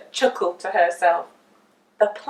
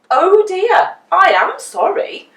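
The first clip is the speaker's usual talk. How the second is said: higher, loud mock apology; old Mister Chips; 8 semitones higher, 10 dB louder, slower than usual. A narrator's reading. The carpenter pretending to apologise to Mister Nosey, loud. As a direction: fake sorry, pitched up. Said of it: "said old Mister Chips".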